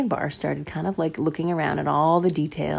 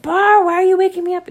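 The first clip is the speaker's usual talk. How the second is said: put-on higher, louder cranky little girl; grumpy sister; 13 semitones higher, 11 dB louder than usual. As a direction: complain as the woken-up sister. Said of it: "And she said".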